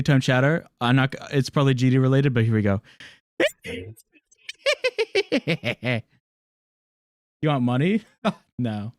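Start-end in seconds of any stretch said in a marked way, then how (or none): 4.5-6.0 shrill cackling witch laugh; evil witch laugh. Shown as a demonstration. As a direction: let out a wicked crone cackle. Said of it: "Here's my evil witch laugh".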